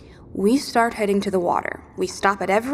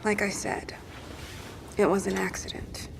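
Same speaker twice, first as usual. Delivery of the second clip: weak whisper; 10 dB quieter than usual. Acted. Injured Sharon, weak, defensive.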